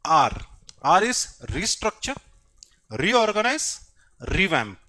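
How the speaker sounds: slow keywords with pauses between; dictation. Real, not acted. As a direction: dictate each word separately and firmly. (एक-एक शब्द धीरे लिखवाते हुए)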